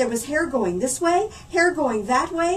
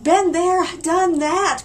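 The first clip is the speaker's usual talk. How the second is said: groaning; 7 semitones higher, 6 dB louder, much slower than usual. Acted. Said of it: "groaned Oliver".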